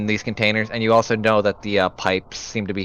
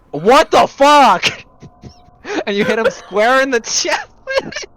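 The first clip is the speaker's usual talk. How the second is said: high, loud laughing disbelief; 6 dB louder than usual. Real, not acted. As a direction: cry it out through laughter. GM laughing at absurd throw.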